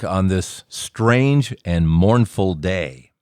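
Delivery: slow lyric quote. Shown as a demonstration. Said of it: "it says things like".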